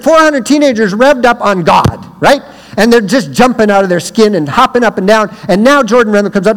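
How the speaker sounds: usual speaking voice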